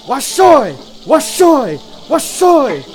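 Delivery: high, loud, swooping down at the end; high-pitched rallying chant; Namazu vendor cry. Acted. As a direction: hawk it like an excited little fish-folk vendor. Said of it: "a bunch of Namazu just standing on the top going".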